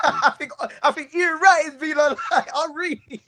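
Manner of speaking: words breaking into giggles; laughing. Real, not acted. Host cracking up mid-sentence about Ian Wright, laughing.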